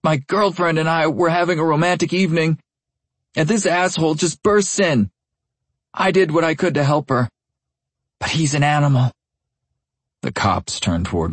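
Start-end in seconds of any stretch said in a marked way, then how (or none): 0.0-9.1 high, nasal voice choked with blood. A narrator's reading. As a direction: talk through a bloody broken nose. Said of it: "in a nasal, blood-choked voice".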